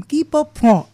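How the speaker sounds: "Qui pro quo" lifted high, kee-po-PWOH; attempted French pronunciation. Shown as a demonstration. Teacher retrying "Qui pro quo" after correction, French-style attempt. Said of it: "Me falta un novio francés para poder".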